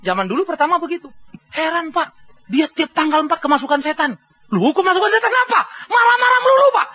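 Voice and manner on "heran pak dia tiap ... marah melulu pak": high, laughing complaint about his wife; complaining husband. Retold or imitated. Husband grumbling to the pastor, high and laughing. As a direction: pitch it up and laugh through the grumble.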